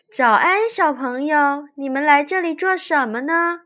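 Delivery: high, loud sweet lake goddess; sweet, gentle goddess voice. A narrator's reading. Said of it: "温柔的声音问二人".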